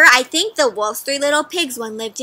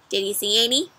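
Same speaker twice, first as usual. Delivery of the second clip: plain reading voice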